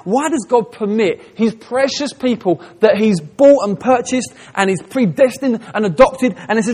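usual speaking voice

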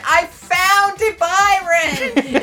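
high, loud, drawn-out yell of discovery; mock triumphant yell. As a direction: re-enact a library outburst at full volume. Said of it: "after I was like".

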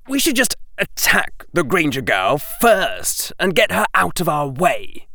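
put-on a sly young boy plotting against a rival; Draco voice.

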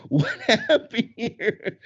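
high, slow, laugh-filled question; laughing bewilderment. Retold or imitated. Dancer baffled at the crowd turning, high laughing disbelief. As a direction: laugh through your disbelief.

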